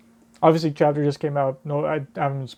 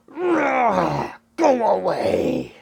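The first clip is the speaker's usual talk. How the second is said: high, very slow wailed command; drawn-out cry; 6 semitones higher, much slower than usual. Acted. Reader acting out the battle, drawn-out cry. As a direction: stretch it into a long hammed-up cry.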